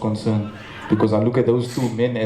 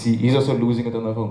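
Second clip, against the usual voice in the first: ordinary delivery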